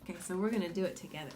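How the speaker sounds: normal talking voice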